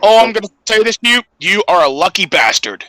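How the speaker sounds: usual speaking voice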